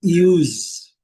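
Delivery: "use" dito iuz, com i inicial; English use. Shown as a demonstration. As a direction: say "use" as yooz. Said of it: "A primeira palavra". ("use" pronunciado iuz, com som de Z)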